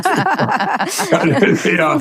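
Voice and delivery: breaks into a high, bright laugh; real laugh. Real, not acted. Telling the podcast host about the rally, hearty laugh. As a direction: let a real laugh spill out. Crowd reaction retold, laughs along.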